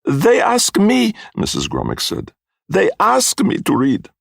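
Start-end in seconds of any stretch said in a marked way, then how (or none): 0.0-1.1 high, loud, slow protest in broken English; protesting foreign fortune-teller. A narrator's reading. Missus Grummick answering the blame, protesting. 2.7-4.1 high, loud, slow protest in broken English; protesting foreign fortune-teller. A narrator's reading. Missus Grummick answering the blame, protesting.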